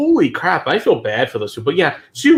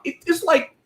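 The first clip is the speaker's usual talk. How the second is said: exasperated disbelief; 13 semitones higher than usual. Retold or imitated. Retelling a disappointing beach.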